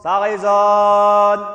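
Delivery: slow, drawn-out words to make the hall ring; hallway echo. Shown as a demonstration. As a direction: stretch it out to test the echo. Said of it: "C'est impressionnant comme ça résonne ici". (voix qui résonne dans le hangar)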